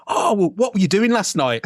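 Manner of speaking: high teasing question; cheeky curious question. Retold or imitated. Host teasing hungover guest with a high cheeky question.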